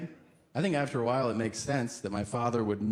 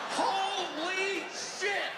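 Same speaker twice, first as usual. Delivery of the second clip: high, drawn-out startled yelp; mock terrified scream; startled shriek; 3 dB quieter, much slower than usual. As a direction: jolt awake in fright. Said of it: "I was like".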